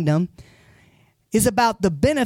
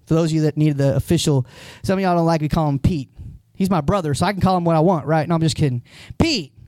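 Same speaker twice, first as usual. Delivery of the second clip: normal talking voice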